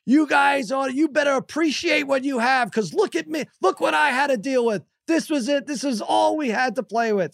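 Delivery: hammed-up scolding dad; mock yelling at kids. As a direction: lecture the ungrateful kids. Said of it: "now I'm yelling at them".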